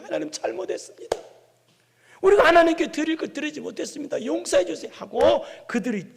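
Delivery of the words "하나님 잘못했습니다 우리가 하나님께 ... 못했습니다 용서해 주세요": high-pitched acted plea for forgiveness; repentant people praying. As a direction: confess to God on behalf of a chastened people. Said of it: "책망을 달게 받고 백성들이". (높은 목소리로 회개하며 빌듯이)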